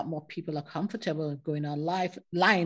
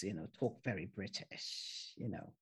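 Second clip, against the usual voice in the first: a hint of a posh British accent, low and soft; mild imitation of grandfather's British accent; 11 semitones lower, 13 dB quieter than usual. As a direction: lightly put on a proper British accent. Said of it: "talk like this".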